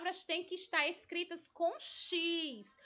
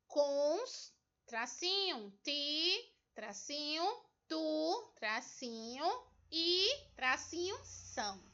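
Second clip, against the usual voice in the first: each piece of cons-ti-tu-i-são set apart by pauses; split into syllables; 4 dB quieter, slower than usual. Shown as a demonstration. Teacher dictating the word's division to pupils, syllable by syllable. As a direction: dictate cons, ti, tu, i, são one by one, saying "tracinho" between them. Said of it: "vão separar essa palavra em sílabas".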